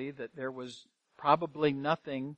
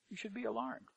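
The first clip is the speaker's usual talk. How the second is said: the warning echoed, far softer; hushed repeat; 4 semitones higher, 17 dB quieter, faster than usual. Real, not acted. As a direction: let it sink in, almost under the breath.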